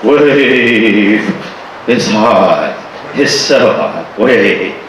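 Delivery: low, lazy, worn out by the heat; too hot to finish a word; heat-exhausted mumble. Retold or imitated. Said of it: "They go".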